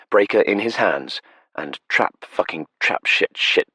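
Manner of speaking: usual speaking voice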